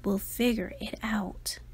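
soft unvoiced whisper. A narrator's reading. Parents whispering fondly about their daughter, a soft whisper. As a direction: breathe it gently. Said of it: "They smiled and whispered".

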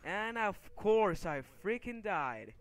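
loud, slow, high-pitched sarcastic fury; exasperated outburst. Real, not acted. Gamer reporting yet another death, exasperated outburst. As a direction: stretch each word in real frustration. Has just died again.

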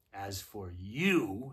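slow, low, drawn-out threat; menacing witch. A narrator's reading. Witch turns on Edmund, slowly menacing. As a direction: stretch it out low, leaning on "you".

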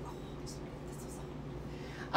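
barely audible whispered coo; hushed cooing. Acted. Petting a squishy sea hare, whispered cooing. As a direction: whisper it in mock awe while petting.

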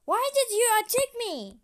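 high, loud, fast accusation; angry shout. Acted. Toy character confronts its attacker, shouting. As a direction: yell it like you were hit.

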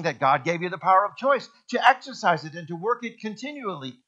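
ordinary talk, nothing marked

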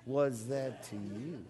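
slow, stressed WAS, rising at the end; led call-and-response. Shown as a demonstration. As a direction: say "Was that to you?" slowly, leaning on WAS. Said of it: "get your hands involved".

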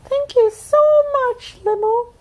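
very high small voice; a grateful sister; high squeaky thanks; sweet sister Pai Hai. A narrator's reading. Lani speaks.

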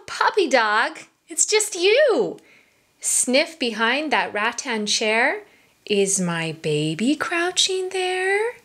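plain reading voice

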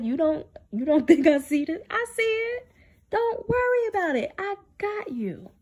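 a hint of a comforting Lord; lightly voiced God. God reassuring her, higher voice.

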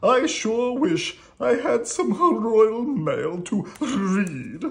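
loud, high, drawn-out royal whine; hammed-up high kingly complaint; grumbling king. A narrator's reading. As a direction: wail it slowly like a thwarted king. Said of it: "he grumbled".

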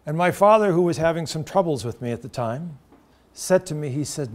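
normal talking voice

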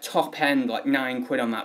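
ordinary delivery

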